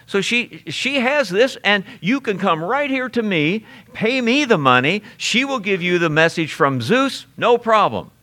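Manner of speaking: a breezy, lightly voiced huckster girl; hawking fortune teller.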